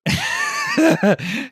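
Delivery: high burst of laughter; real laughter while telling the story; loud laugh. Real, not acted.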